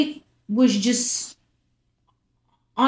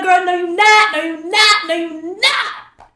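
high and loud, slowing on the last no; emphatic loud refusal; 9 semitones higher, 5 dB louder than usual. Retold or imitated. Telling her friend she's not in love, loud and high. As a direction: shut her down hard, dragging it out.

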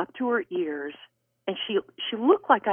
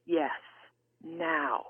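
hushed divine prompting; 6 semitones higher, 6 dB quieter than usual. Retold or imitated. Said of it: "I could sense the Lord saying".

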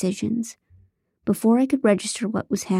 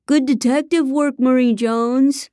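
shouted; 6 semitones higher, 3 dB louder than usual. Acted. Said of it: "David N shouted".

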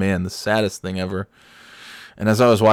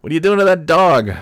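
high, loud mock challenge; calling out; 9 semitones higher, 7 dB louder than usual. Narrator calling to old lady about the dog, calling out. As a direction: call across the street.

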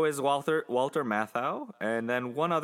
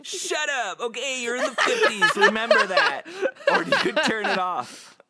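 much higher and louder, giggling shut up; laughing protest; 7 dB louder than usual. Real, not acted. Protesting the co-host's teasing while laughing. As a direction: fight through the laughter.

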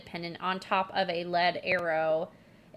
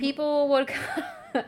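higher, slow words dissolving into laughter; breaking into laughter; 7 semitones higher, slower than usual. Real, not acted. Host restarting the story after apologising, cracking up. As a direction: crack up mid-sentence.

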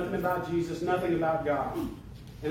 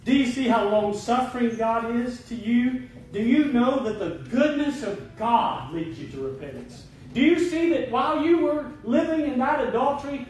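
raised, pleading pulpit voice; impassioned preaching appeal; 8 semitones higher, 4 dB louder than usual. Acted. Preacher urging a sinner back to Jesus, impassioned appeal. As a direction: press each question home, pitched high.

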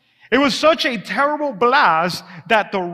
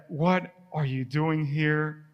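slow, much softer and lower voice from beyond; the still small voice of God asking, slow and soft; 5 semitones lower, 8 dB quieter, slower than usual. A narrator's reading. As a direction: let God's voice come as a slow hush. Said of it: "And a voice said".

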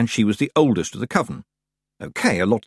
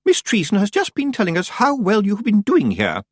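much higher, poised witch voice; smooth Miss Tick, unruffled; 11 semitones higher, 4 dB louder than usual. A narrator's reading. Miss Tick passing on Miss Treason's praise, smooth. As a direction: praise her smoothly in a high voice.

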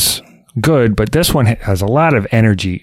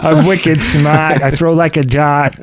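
slow, high Boston accent put-on; 6 semitones higher than usual. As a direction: ham up a thick Boston accent. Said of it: "a really thick Boston accent".

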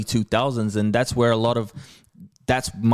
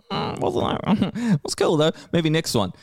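lukewarm audience; 7 semitones higher than usual. Retold or imitated. Viewer letting a comedian down over a skit, mock lukewarm. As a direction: imitate a hesitant, unconvinced viewer.

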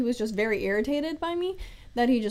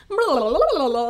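mocking blah blah; 2 semitones higher, 6 dB louder than usual. Mocking chatter of others.

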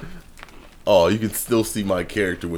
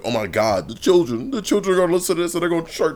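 mock outrage; 11 semitones higher, 4 dB louder than usual. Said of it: "the way he's like".

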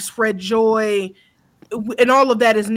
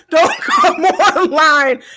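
laughing; 3 semitones higher, 5 dB louder than usual. Real, not acted. Rant with laughter.

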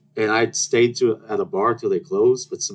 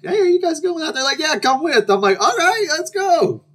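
excited invite; 4 dB louder than usual. Retold or imitated. Friends invite her out.

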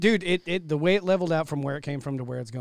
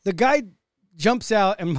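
normal talking voice

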